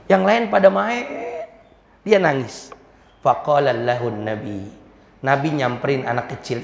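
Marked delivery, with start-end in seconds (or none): none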